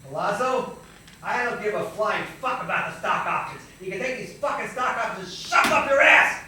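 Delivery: loud, high furious telling-off; raging rant at the bigoted boss; angry outburst. Retold or imitated. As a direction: let the rage out at the boss.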